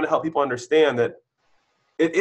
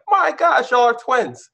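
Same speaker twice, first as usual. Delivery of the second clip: amazed gushing; 10 semitones higher, 4 dB louder, slower than usual. Retold or imitated. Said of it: "it's like".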